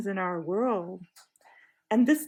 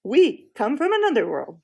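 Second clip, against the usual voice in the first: insistent; 4 semitones higher, 7 dB louder than usual. Acted. Jill insists angrily.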